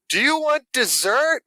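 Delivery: over-enunciate it as if to someone with a hearing aid; drawn-out, high-pitched dessert offer; waitress shouting to the deaf. Retold or imitated. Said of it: "She's like".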